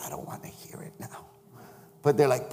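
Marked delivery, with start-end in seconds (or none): 0.0-1.3 whispered brush-off; mock whisper. Chaplain whispering to people wanting to confess. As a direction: breathe it like a hushed aside.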